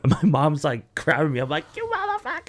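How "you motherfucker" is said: very high, held-back falsetto scream; imitated screaming, kept soft and sky-high; mock pained cursing. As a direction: curse the doctor in a squealed reenactment. Retold cursing at doctor in pain.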